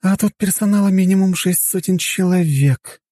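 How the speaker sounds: high, slow musing of a woman; thoughtful. Acted. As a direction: think it over aloud, drawn out and high. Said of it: "задумчиво проговорила Флорена". (задумчиво, протяжно)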